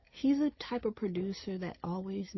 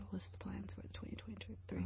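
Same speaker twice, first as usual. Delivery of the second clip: much softer, breathy whisper; whispered repeat; 10 dB quieter than usual. Real, not acted. Asks question then whispers repeat.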